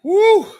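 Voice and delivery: a loud, high exhale of relief; loud whew. Real, not acted. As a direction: let out a big whew. Exhale after the rant.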